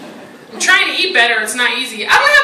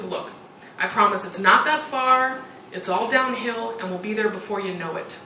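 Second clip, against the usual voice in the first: soothing husband; 6 semitones lower, 8 dB quieter than usual. Retold or imitated. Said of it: "and he goes".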